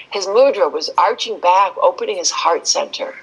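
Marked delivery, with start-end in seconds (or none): none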